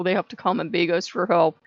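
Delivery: ordinary talk, nothing marked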